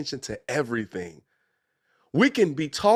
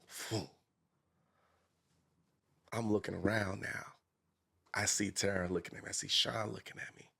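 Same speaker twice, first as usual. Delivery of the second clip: ordinary talk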